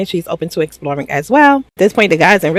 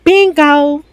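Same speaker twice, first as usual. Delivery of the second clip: triumphant bingo; 9 semitones higher than usual. Retold or imitated. Anaya shutting down the guy, sassy and triumphant. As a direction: shut him down with sass.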